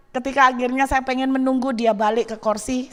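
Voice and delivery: ordinary talk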